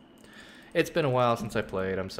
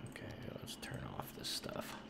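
whispered aside; 12 semitones lower, 14 dB quieter than usual. Real, not acted. Muttered aside while clicking.

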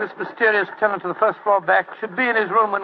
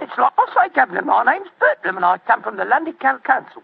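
raised, higher Cockney protest; Cockney accent; 4 semitones higher than usual. Acted. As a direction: insist in Cockney to the angry tenant. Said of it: "My Cockney accent".

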